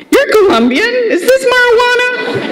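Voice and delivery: high, mock-accusing friend voice; suspicious friends. Friends quizzing the Colombian girl, high and teasing. Friends hand her the bag.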